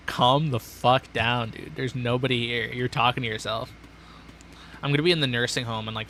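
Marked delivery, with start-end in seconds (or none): 0.1-1.7 drawn-out slow scolding; slow exasperated self-talk; slow calm down. Real, not acted. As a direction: stretch it out to calm yourself. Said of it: "bro, calm down".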